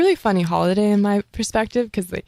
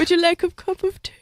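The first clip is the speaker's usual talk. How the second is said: higher, quick, hammed-up British accent; mock British accent; 9 semitones higher, faster than usual. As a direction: play a prim Queen-like British accent. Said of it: "I still can barely do a British accent".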